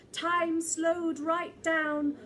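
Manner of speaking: ordinary delivery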